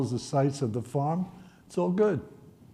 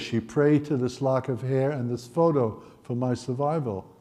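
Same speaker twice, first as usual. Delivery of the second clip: ordinary talk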